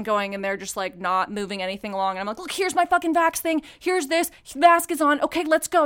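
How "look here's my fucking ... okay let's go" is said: high-pitched, exasperated hurrying; impatient. Retold or imitated. Hurrying slow ER check-in along.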